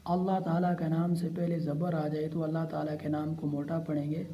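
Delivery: ordinary talk, nothing marked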